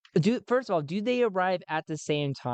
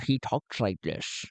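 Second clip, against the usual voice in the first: lower, sophisticated Connery-like voice; Len Onya; 4 semitones lower, 3 dB quieter than usual. Shown as a demonstration. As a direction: play a cultured Sean Connery type. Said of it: "almost like a Sean Connery kind of thing".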